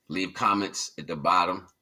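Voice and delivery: ordinary talk, nothing marked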